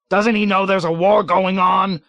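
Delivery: loud, high outburst of disbelief; sudden yell. A narrator's reading. Colonel yelling in disbelief at his deputy. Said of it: "yelled out suddenly".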